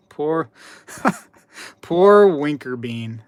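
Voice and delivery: mock sympathy broken by laughter; laughing pity. Real, not acted. About the banned chatter, slow laughing mock pity.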